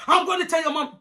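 high-pitched tattling threat; threatening auntie. Retold or imitated. Auntie threatening to tell the girl's mom, high-pitched warning. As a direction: imitate an outraged auntie pitching up.

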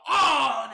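sharp loud cry of pain; retold scream. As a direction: yelp as the needle goes in. Needle goes in, scream. (iğne girince acıyla bağırma)

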